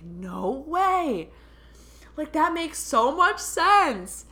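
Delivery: high, drawn-out disbelief; retold amazement. Client reacting to medium's explanation, amazed.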